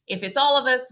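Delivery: normal talking voice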